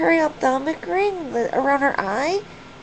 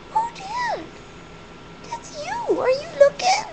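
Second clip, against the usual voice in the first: high-pitched pet talk. Real, not acted. Owner showing her bird itself on screen, high-pitched pet talk.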